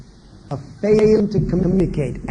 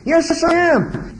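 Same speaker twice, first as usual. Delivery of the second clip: put-on child's answer; 10 semitones higher, slower than usual. Child answers upset parent.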